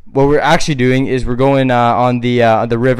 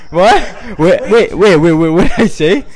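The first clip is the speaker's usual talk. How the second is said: wait wait, laughing and louder; laughing confusion; 4 semitones higher, 4 dB louder than usual. Real, not acted. Nephew laughing at his own slip, higher and louder.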